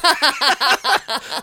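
high giggling burst; laughing at the scene he pictures. Real, not acted. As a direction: break into a high cackle. Laughing mid-story.

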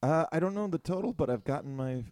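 normal talking voice

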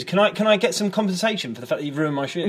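high-pitched, flustered protest; indignant complaint. Acted. Customer demands compensation for ruined shoes, high-pitched and indignant.

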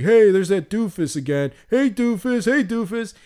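put-on high, taunting bratty kid voice; sing-song taunt at a classmate; mocking kid. [name] making fun of Manny, high taunting kid. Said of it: "making fun of Manny".

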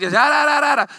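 imitating the Cajun secretary who never stops talking; mock Cajun chatter. Secretary who talks nonstop, imitated as babble. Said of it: "that monotone voice".

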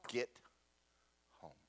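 soft, gentle dismissal with a pause between words; mock-kindly order. God sending the preacher back from Atlanta, softly kind. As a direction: quietly send them away, kindly. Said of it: "He really kindly said".

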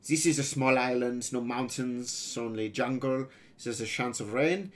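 put-on foreign-accented boy pirate laying out a plan; accented boy pirate explaining eagerly.

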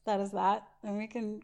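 normal talking voice

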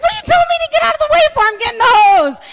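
high, loud, rapid-fire retort; indignant yell. Retold or imitated. Isaiah answering back while getting the hose, indignant yelling. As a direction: yell back in mock exasperation, very fast. Acted bickering during the fire.

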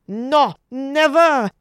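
high, loud, drawn-out refusal; indignant shout. A narrator's reading. First waitress to Margaret over the insult, indignant shout. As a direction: shout a slow, outraged refusal. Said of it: "shouted the first waitress".

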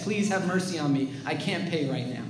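acted soft, low plea; begging. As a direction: beg meekly, voice sinking away. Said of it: "he begs".

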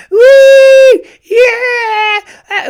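very high, loud mock wailing; dying goat wail. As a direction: shriek it out like a dying goat, imitating a distraught fan. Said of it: "She sounded like a dying goat".

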